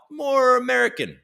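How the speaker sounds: high, exaggerated American twang; put-on American accent. Shown as a demonstration. Streamer showing what accents he can do, mock American twang.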